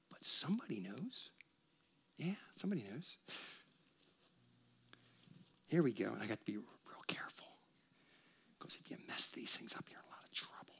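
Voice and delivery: soft, careful whisper; a real hushed whisper. Real, not acted. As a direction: hush down as if handling something fragile. Pastor handles fragile items.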